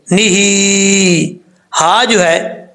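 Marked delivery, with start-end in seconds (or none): 0.1-1.3 "نِهِ" slowly, the hee held long; lengthened vowel. Shown as a demonstration. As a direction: stretch the last vowel of "نِهِ" on a level note. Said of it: "ایک الف کی مقدار لمبا کریں گے". ("نِهِ" کو لمبا کھینچ کر)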